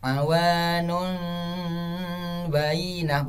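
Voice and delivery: "Awanum" ending in a held n-like hum; slow, incorrect nasal in "Awanum"; wrong recitation. Shown as a demonstration. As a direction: chant "Awanum bainah" without closing cleanly to m. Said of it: "bukan seperti itu".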